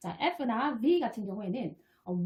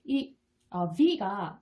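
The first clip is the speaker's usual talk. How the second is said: ordinary talk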